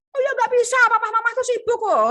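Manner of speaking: high, loud dismissive parent voice; parent brushing off. Shown as a demonstration. Teacher voices a parent dismissing the request, high and loud. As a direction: wave it off lightly. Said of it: "telepon aku ya".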